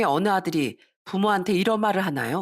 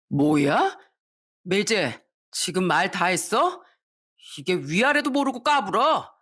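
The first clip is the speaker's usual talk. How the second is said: indignant retort; slower than usual. Acted. Son warns his brother-in-law to know his place, indignant retort. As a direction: take offence and pull rank.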